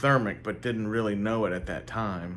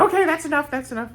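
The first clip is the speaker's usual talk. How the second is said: very high-pitched character voice; high eccentric character voice; Bobby Justin; 4 dB louder, faster than usual. Retold or imitated. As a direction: ask in a high, put-on voice. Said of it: "do a Bobby Justin impression".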